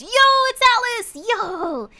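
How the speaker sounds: loud, high hyped greeting; hollering in high-pitched excitement; excited shout. Real, not acted. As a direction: shout out a thrilled hello to a friend. Greets a raiding streamer friend.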